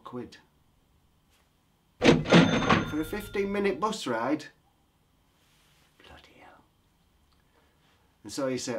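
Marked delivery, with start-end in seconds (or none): 2.0-4.5 higher, louder, slow disbelief; can you believe this price; incredulous outburst. Real, not acted. Hearing the bus fare, loud high incredulous outburst. 6.0-6.6 breathless whispered swear; whispered curse. Real, not acted. Reacting to the bus fare, whispered curse. Aside after price shock.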